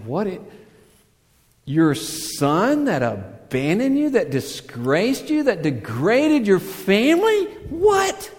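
bursting out on the last what; scandalized disbelief. Retold or imitated. Onlookers scandalized by the running father, incredulous outrage.

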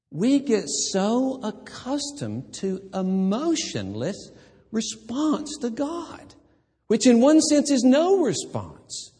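slow, climbing high pleading preacher cry; fervent preaching. Acted. Preacher to his congregation, rising fervent plea. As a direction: lift it up and drag it out, pleading.

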